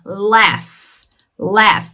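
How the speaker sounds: "laugh" said as LAF, ending in F. Shown as a demonstration. Teacher showing learners the GH sound, "laugh" pronounced with final F. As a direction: model "laugh" as a single syllable with final F. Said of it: "tem o som de F".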